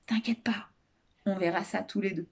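soft, confiding reassurance; hushed, gentle secret between two; murmured in ear. A narrator's reading. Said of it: "et lui murmura à l'oreille".

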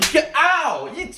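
high, loud, outraged yell; just got slapped. Acted. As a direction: yell after another slap. Slapped again mid-sentence.

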